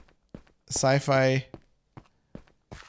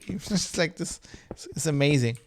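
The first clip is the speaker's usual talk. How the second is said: ordinary talk, nothing marked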